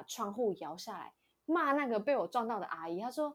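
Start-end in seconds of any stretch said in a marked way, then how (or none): none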